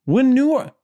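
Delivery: high, loud New York false start; New York accent attempt. Co-host starting the film blurb, exaggerated New York accent. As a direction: launch loudly into mock New York. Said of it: "like a New York accent?".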